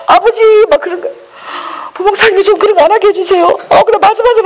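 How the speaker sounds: high, pleading voice calling on God; hammed-up begging prayer; mock prayer. Pastor retelling her old prayers, high and pleading.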